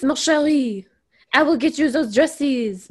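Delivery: a hammed-up cartoon French accent; playful French accent, mocking the modiste's act; over-the-top French accent. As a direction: lightly play the modiste's fake French accent. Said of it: "she was talking like this".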